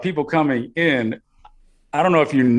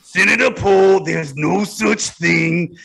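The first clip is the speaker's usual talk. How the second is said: Fauci impression. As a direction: lecture the senator like Fauci. Impersonating Fauci at hearing.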